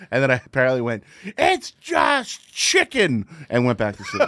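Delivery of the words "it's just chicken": slow, high mock scream; drawn-out nonsense night-terror wail; retold scream. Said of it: "I just started screaming at him".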